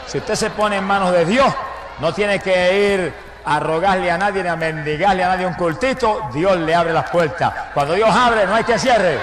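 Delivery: loud, rising pulpit preaching; preaching at full force. Real, not acted. Preacher to the congregation, full-force preaching. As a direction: drive each phrase home to the church.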